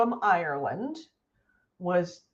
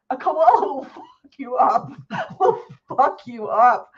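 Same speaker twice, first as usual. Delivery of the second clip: laughing; 5 semitones higher, 4 dB louder than usual. Real, not acted. Laughing through koala joke.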